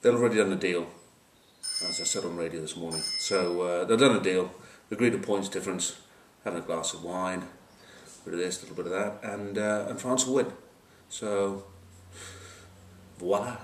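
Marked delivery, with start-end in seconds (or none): none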